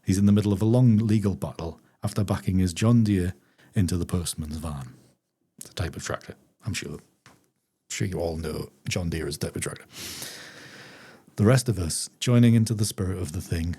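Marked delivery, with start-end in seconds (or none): 5.6-9.9 softer. Real, not acted. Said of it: "aside to audience".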